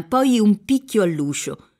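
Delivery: ordinary delivery, nothing marked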